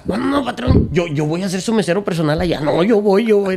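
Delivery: an overeager "no, no, patrón"; eager waiter. Retold or imitated.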